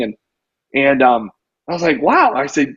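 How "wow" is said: a high, loud retold wow; amazed wow. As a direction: drop your jaw in mock amazement. Retelling his reaction to surprising news.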